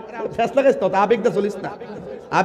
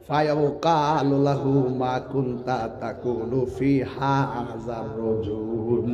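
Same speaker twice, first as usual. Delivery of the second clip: softer, very slow Arabic recitation; 5 semitones lower, 7 dB quieter, much slower than usual. Shown as a demonstration. Preacher showing how he would recite softly, slow and quiet. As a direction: recite gently, as if preaching in another tune. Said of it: "আমি আস্তে আস্তে কইলাম".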